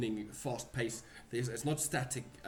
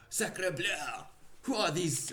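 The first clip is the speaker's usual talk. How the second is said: high alarmed French exclamation; startled shout; 8 semitones higher, 3 dB louder than usual. Acted. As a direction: cry out in shock, French flair. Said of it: "he kinda shouts at you".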